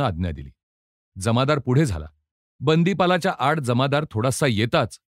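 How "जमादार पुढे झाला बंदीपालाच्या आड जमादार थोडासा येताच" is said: usual speaking voice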